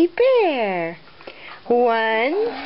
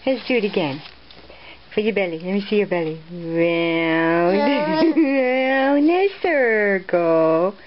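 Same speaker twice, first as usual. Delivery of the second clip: ordinary talk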